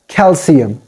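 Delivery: "calcium" with a clear s sound for the c; corrected calcium. Shown as a demonstration. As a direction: say "calcium" as kel-see-um. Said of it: "It should be pronounced".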